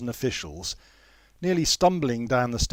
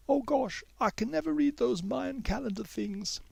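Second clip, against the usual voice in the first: timid schoolgirl checking in; girl Mei Yi; 13 semitones higher than usual. A narrator's reading. Mei Yi asks the teacher if she is busy, higher girl voice.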